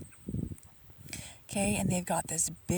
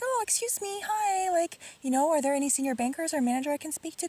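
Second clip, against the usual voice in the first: much higher, sugary polite ask; sweet polite voice; 9 semitones higher than usual. Retold or imitated. Calling politely to the passing manager, sweet and high-pitched. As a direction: charm the manager into stopping. Politely hailing the important lady.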